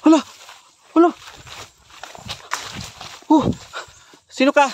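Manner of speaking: loud, high startled yell; frightened yell. Real, not acted. Gamer jumping at a scare, high frightened yell. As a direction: yelp it out in shock.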